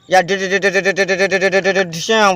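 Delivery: gun noises. Pretend. Firing toy gun at animals.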